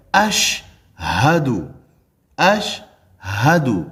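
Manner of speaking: اشہدو very slow, sh closed then a clear h; correct ash-hadu. Shown as a demonstration. Teacher demonstrates the correct sound, very slow. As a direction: stretch اشہدو to show the jazm on sh. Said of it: "شین پر جزم ہے".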